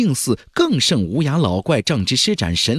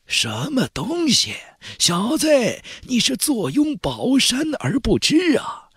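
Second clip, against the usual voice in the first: much higher, slow and mocking; old Taoist's voice; 11 semitones higher than usual. A narrator's reading. Old Taoist chiding Zhang Fan for not knowing the treasure. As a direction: scoff like a know-it-all old master.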